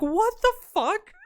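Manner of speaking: high, slow stunned what the fuck; a high, slow disbelieving outburst. Retold or imitated. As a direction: drag it out in disbelief. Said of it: "I was like".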